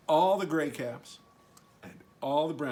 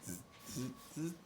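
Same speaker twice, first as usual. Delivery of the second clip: soft, breathy mocking zzz; monkey buzz; 10 dB quieter than usual. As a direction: tease back like monkeys. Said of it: "and said".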